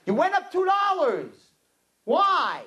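high, indignant penny-pincher; indignant cheap customer. Retold or imitated. Cheap customer challenges the florist's price, high mock outrage. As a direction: squawk the price complaint up high. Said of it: "The guy's the cheapest guy".

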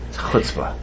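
breathy whisper under the breath; hushed disapproving aside. Real, not acted. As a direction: drop to a whisper for the verdict. Teacher's aside on son's cheek.

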